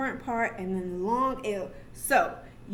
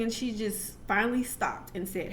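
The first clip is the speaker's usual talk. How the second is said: ordinary talk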